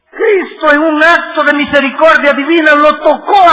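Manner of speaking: loud, high, ringing sermon delivery; impassioned pulpit cry; full-force preaching. Acted. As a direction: preach it out to the back pew. Climax of the wrestling story.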